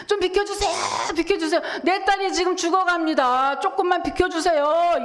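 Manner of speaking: a father begging the crowd to let him pass; desperate pleading. Acted. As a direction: push through the crowd, pleading. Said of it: "이러고 사람을 사이를 막 밀치고".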